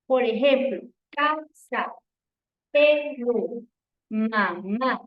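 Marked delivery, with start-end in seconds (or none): 1.1-5.0 "casa, perro, mamá" broken into two beats each; split into syllables. Shown as a demonstration. Teacher showing pupils two voice beats, syllable by syllable. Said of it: "tiene que pronunciar las palabras y escuchar".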